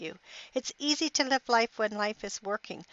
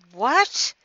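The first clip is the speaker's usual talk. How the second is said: sharp, louder startled what; wife hearing his offer, louder in disbelief; angry what; 2 semitones higher, 7 dB louder than usual. Retold or imitated. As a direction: snap it back in disbelief. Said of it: "She looked at him angrily".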